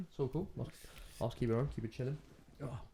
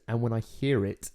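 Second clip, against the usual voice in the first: usual speaking voice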